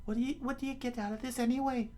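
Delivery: exasperated high falsetto; high, quickening indignant question; Nami. Acted. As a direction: complain in a put-on female voice. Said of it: "Nami said".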